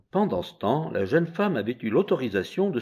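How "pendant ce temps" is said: ordinary delivery, nothing marked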